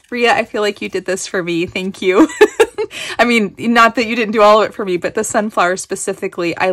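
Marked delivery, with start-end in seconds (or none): 2.3-3.1 bright burst of giggling, much higher; high delighted giggle. Real, not acted. Thrilled friend giggles over the sunflowers, high delighted laugh.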